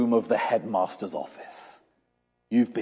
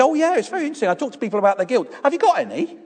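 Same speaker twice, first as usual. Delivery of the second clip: much higher, hammed-up chatty; mock chirpy; 13 semitones higher, much faster than usual. Pastor chats up the hairdresser, high mock-cheerful. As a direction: play the cheerful salesman of guilt. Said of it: "And you say".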